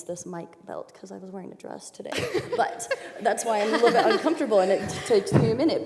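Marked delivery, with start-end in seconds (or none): 2.1-5.8 laughing through the words; giggling as she explains herself. Real, not acted. Explaining her uncomfortable mic belt to the group, laughing.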